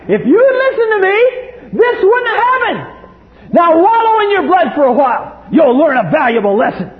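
put-on a harsh God shouting at the bleeding child; cruel scolding God. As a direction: berate the hurt child without mercy. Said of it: "what many people think of God".

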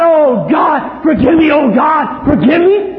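loud, high wailing plea for forgiveness; mock groveling prayer. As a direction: ham up a desperate sinner begging God.

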